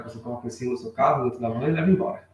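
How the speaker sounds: ordinary talk, nothing marked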